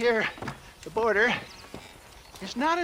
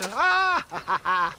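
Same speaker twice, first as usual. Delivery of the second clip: triumphant cackle; 2 semitones lower, slower than usual. Acted. Old salesman to John, gleeful cackle on finding it. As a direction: crow with delight at the find.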